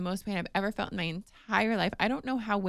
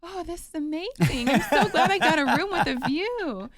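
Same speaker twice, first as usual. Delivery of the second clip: much higher, playful starry-eyed gush; make light diva; 9 semitones higher, 4 dB louder than usual. Retold or imitated. Wife in labor joking about the view, playful diva voice. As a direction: ham it up like a pop star. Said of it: "I'm looking up at the view going".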